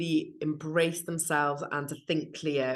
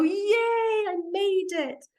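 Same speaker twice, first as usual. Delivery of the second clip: triumphant cheer; 4 dB louder, much slower than usual. Retold or imitated. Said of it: "you get to the top and you go".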